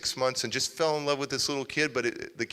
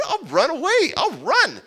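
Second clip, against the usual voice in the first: put-on defiant kid threat; 14 semitones higher, 6 dB louder than usual. Kid threatening to run away.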